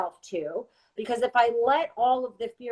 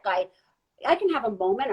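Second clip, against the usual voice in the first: ordinary talk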